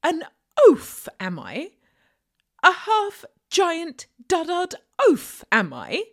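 outraged, rising to a loud cry; indignant shout. Acted. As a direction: bellow the insult back, offended. Insulted half giant bristles.

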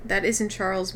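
plain reading voice

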